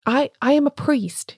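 a priest shaken, voice climbing high; febrile agitation. A narrator's reading. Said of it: "febrile and feminine agitation".

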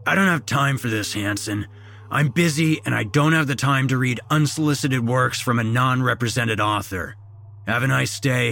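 mildly irritated boss dismissing someone; brusque dismissal. A narrator's reading. Busy boss dismisses the employee.

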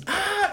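short high mock scream of terror; a sudden high yelp of fright. Friend fleeing footsteps, retold scream.

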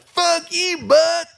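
high, loud mock yell at the booth; heckler yell. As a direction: hurl it up like a drunk heckler. Retold heckler cursing the announcer.

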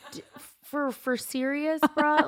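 high, drawn-out disbelief; incredulous "are you serious" tone; incredulous retort. Retold or imitated. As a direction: stammer it out in high-pitched mock disbelief. Mocking incredulous reply to Caleb.

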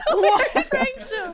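high, slow laughing repeat. Retold or imitated. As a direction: say it cracking up.

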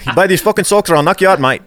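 high, loud, fast threatening fighter; swaggering loud taunts. Pretend. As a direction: threaten like a cocky brawler. McGregor threatening to sell socks.

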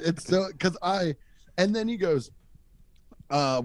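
usual speaking voice

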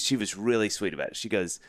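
ordinary delivery

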